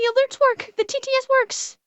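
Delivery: high, relieved; relieved excitement. Real, not acted. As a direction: sigh it out in relief. Relief that alerts work.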